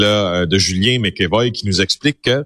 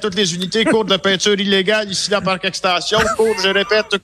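police radio; 10 semitones higher than usual. Retold or imitated. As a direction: blare it like a dispatch call. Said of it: "qui appelle à la centrale".

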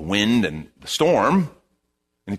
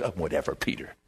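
low, much softer scoff; muttered scoffing; 8 semitones lower, 10 dB quieter than usual. Retold or imitated. Disciples scoffing at Peter under their breath. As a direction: mutter it like a dismissive onlooker.

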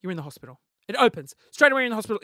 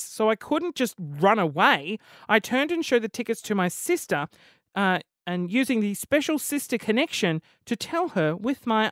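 dramatic story reading; 5 dB louder than usual. Acted. Podcaster reading a listener's embarrassing story, dramatic reading. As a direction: build suspense toward the disaster.

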